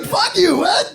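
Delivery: high-pitched, outraged comeback; hammed-up indignant protest; indignant retort. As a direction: snap back high in mock outrage. Acted argument with voice in head.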